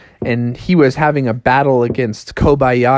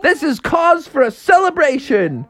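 jubilant Joey Chestnut; 12 semitones higher than usual. Acted. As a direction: whoop it up, pitched high. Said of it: "Joey Chestnut was like".

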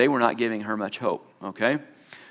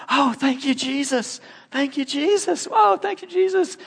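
high, far-off cry of thanks; overjoyed thanks; 13 semitones higher than usual. Retold or imitated. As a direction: let the thanks burst out, much higher. Woman healed, thanking Jesus.

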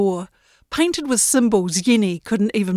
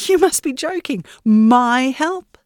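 higher, insistent protest; indignant young Yenny; 7 semitones higher than usual. Acted. Yenny insisting to the great ship, indignant. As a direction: push back, stung and proud. Yenny disbelieving, calls herself nobody.

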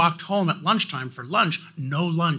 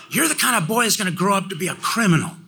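higher, louder, fast mock scolding; teacher scolding; 7 semitones higher, 3 dB louder, faster than usual. Teacher telling the boy he'll be a criminal, fast mock scold. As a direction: rattle off the prediction like a harsh teacher. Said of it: "And she would say things like".

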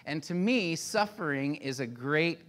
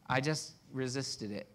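usual speaking voice

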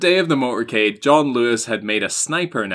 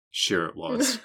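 dry sarcasm; 2 semitones lower, 6 dB quieter, slower than usual. Retold or imitated. Queen doubting the excuse.

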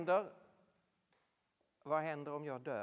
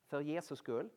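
usual speaking voice

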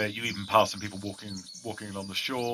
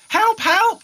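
put-on much higher, louder child's cry for help; child shouting for help; 12 dB louder than usual. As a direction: scream for help like a scared boy. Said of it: "sounds like a young child shouting".

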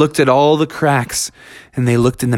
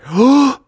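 high sharp gasp; big breath before diving; gasp for air; 10 semitones higher, 3 dB louder than usual. Acted. Diving underwater.

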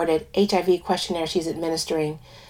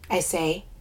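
usual speaking voice

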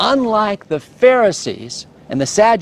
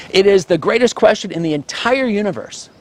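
ordinary delivery

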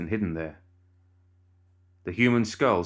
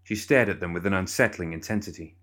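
ordinary delivery

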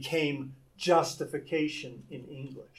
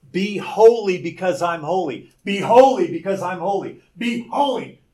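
loud, stern divine order; booming command; 3 semitones higher, 7 dB louder than usual. Acted. God commanding Israel to be holy, loud and stern. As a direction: thunder it like God laying down the law.

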